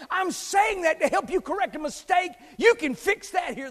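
loud, high, fervent pulpit preaching with shouted quotes; full-force preaching. Acted. Preacher to congregation, retelling the healed man, full-force preaching. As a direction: drive the gospel story home at full volume.

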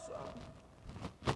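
breathed in a whisper; whispered son. Acted. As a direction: whisper it tenderly. Father urges Son to cross.

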